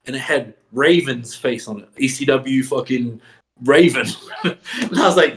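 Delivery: usual speaking voice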